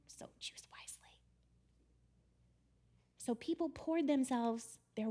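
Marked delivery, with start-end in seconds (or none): 0.1-1.1 breathy stage whisper, far softer; ominous whisper. Acted. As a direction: hush it like a sinister warning. Ominous aside warning.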